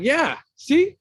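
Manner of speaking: high, loud gloating; squeaky I-told-you-so; triumphant told-you-so. Retold or imitated. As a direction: crow over being right. Retold triumphant reply.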